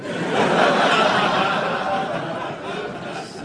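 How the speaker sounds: real loud burst of laughter. Real, not acted. Amused by Borges's reply, laughing loudly.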